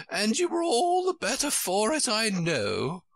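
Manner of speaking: high, emphatic note of triumph; triumphant queen. A narrator's reading. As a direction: score the point in a high queenly voice. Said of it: "the Queen said triumphantly".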